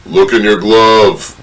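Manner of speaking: loud, slow, drawn-out dad holler; imitated father calling out. Father calling to his kid from the crowd, imitated slow holler. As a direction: holler it slowly like a father from the stands. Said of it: "my father go".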